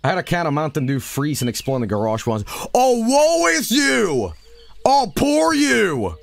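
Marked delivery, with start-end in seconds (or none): 2.7-6.2 high, slow, whiny mock pity. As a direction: drag it out in a squeaky sarcastic voice. Mocking chat's pity.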